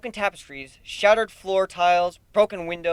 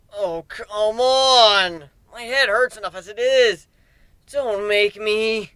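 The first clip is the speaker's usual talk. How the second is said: higher, slow, drawn-out complaint; whiny pained protest; 6 semitones higher, 6 dB louder, slower than usual. Acted. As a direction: whine like a hurt kid. Nightmare whines in pain.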